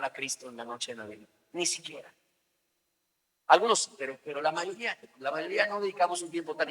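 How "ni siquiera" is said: quiet emphatic echo. Shown as a demonstration. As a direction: echo "Ni siquiera" quietly, letting it sink.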